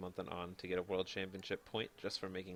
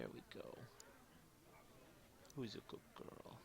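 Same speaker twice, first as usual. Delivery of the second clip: whispered puppy talk; talking to a dog; 4 semitones higher, 16 dB quieter than usual. Real, not acted. Coaxing puppy to camera.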